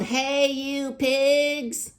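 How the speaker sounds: slow, high, loud shout at the pigs; yell. Acted. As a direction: call out as if to shoo animals.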